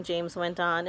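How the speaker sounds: ordinary delivery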